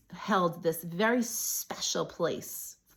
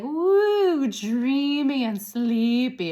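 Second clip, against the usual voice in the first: high, drawn-out mock-dreamy swoon; playfully woozy; 4 semitones higher, 4 dB louder, much slower than usual. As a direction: stretch it out slowly as if floating off. Acting out woozy oil high.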